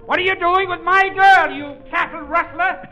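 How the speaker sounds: blustering comic outrage; father shouting at the man with his daughter; mock angry shout. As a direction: bellow at the intruder. Father confronting rival suitor.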